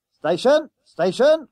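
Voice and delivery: high, loud conductor's shout; calling out. A narrator's reading. Conductor announcing the stop, calling out loudly. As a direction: sing out the stop to the passengers. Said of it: "called the conductor".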